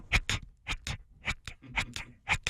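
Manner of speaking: whispered strained grunting; straining against the g's, barely voiced; under heavy g force. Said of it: "I hear him".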